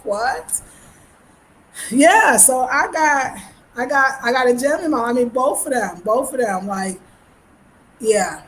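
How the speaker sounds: ordinary talk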